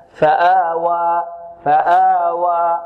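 فآوى very slow, alif held two beats; slow model recitation. Shown as a demonstration. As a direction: chant فآوى slowly with an even alif. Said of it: "كما تحدثنا عن كلمة الأولى". (ترتيل فآوى ببطء ومد الألف حركتين)

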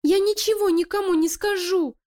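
very high, a hint of a stammering rat; frightened stammer. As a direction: babble a scared promise. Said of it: "заикаясь, пролепетал испуганный крыса". (испуганный крыса лепечет, запинаясь)

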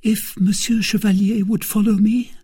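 timid maid, pitched high; a high, tentative request from a servant girl. A narrator's reading. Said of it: "said Kitty timidly".

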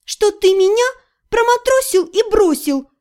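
high, bitter, near tears; bitter, holding back tears. A narrator's reading. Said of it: "горько сказала я, борясь со слезами".

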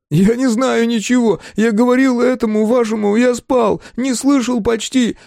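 high, loud, desperate denial; panicked shouting. A narrator's reading. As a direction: cry out in frightened self-defence. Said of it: "закричал Дементьев".

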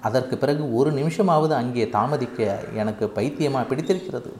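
plain reading voice

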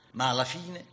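ordinary talk

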